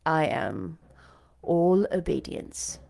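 a hint of a dry, low Mister Rochester. A narrator's reading. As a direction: answer with lazy, courtly irony. Rochester's reply.

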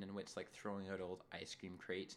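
ordinary talk

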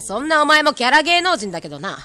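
sharp-tongued. Acted. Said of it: "急に毒舌キャラ".

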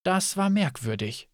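ordinary delivery, nothing marked

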